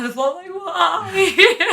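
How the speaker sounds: high, loud, slow mock lament; hammed-up wail. Imagined victim cleaved on the floor, mock wailing. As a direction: wail it slowly, half laughing.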